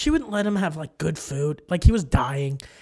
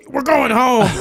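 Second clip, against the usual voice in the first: confused grandpa; 7 semitones higher, 7 dB louder than usual. Retold or imitated. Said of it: "And he goes".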